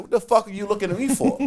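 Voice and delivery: high, loud, indignant yell; retold yell. Player yelling at the coach staring at him, retold indignant yell. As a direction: fire it off high and fast, imitating a yell. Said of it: "I'm yelling at him".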